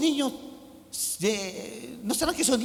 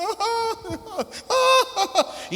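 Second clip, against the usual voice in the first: high burst of laughter; 13 semitones higher than usual. Real, not acted. Laughs at his own mockery.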